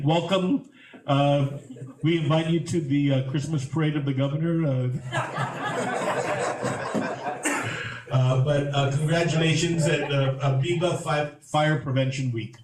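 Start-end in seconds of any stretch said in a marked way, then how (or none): 4.9-8.1 high breathy laugh. Real, not acted. Laughter at parade invite.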